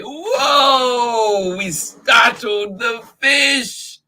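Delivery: loud, high surprised cry; startled shout. Acted. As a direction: yell out as the fish scatter. Fish burst out suddenly.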